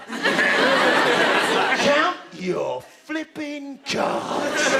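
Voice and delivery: loud, very slow bellow; hammed-up exasperated yell; shouted order. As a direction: drag out each word at full volume. Captain bullying on the birthday.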